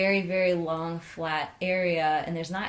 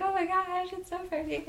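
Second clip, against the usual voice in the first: awed squeal; 13 semitones higher than usual. Retold or imitated. Awed at plane overhead.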